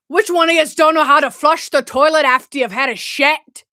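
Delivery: loud Scottish indignation; Scottish accent. Acted. Mimicking the Vine just played, loud Scottish accent. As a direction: bellow the question in broad Scottish. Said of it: "so is that a Scottish accent?".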